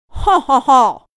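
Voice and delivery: slow booming guffaw; hearty bull laugh. Acted. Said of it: "哈哈大笑".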